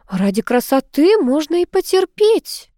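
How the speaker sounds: slow, high, lecturing tone; mentoring. A narrator's reading. Ann lecturing the complaining niece, mentor-like. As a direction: instruct her slowly, like a mentor. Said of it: "менторски заметила Энн".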